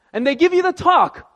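ordinary delivery

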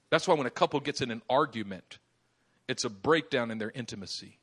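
ordinary talk

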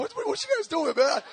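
high stammering what what what; bewildered, voice jumping up; 1 baffled outburst. Retold or imitated. Preacher baffled by screaming kids, high stammered question.

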